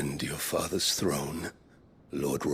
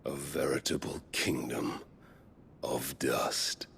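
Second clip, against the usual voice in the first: whispered sneer; 4 semitones lower, 5 dB quieter than usual. Acted. Villain sneers at ruined realm.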